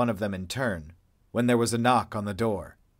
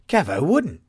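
higher, louder eager interjection; piped up; 7 semitones higher, 7 dB louder than usual. A narrator's reading. Drew M defending Kevo, higher louder pipe-up. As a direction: jump in to defend. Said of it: "Drew M piped up".

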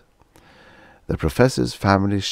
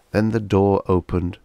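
ordinary delivery

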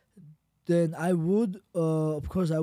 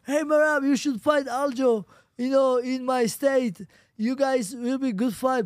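imitated fan; 7 semitones higher, 4 dB louder than usual. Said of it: "He's like".

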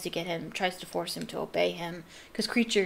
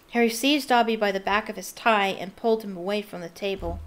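plain reading voice

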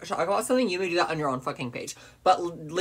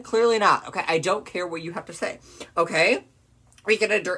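usual speaking voice

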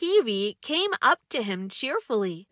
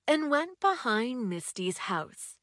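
usual speaking voice

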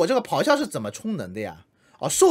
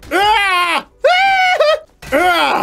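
rising shouted war cry, much higher and louder; battle yell; 10 semitones higher, 7 dB louder, much slower than usual. Real, not acted. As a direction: yell with everything you've got as the axe swings. Battle yells while attacking.

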